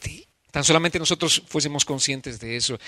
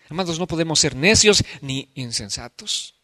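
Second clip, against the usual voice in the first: ordinary talk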